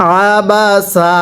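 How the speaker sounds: আ বা সা slow and stretched in one breath; drawn out together. Shown as a demonstration. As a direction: pull the syllables long and linked. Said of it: "একসাথে টান দিয়ে পড়তে হবে".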